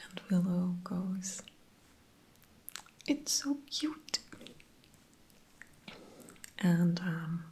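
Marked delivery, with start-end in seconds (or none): 3.1-4.2 cooing. Retold or imitated. Willow cooing at tiny demon.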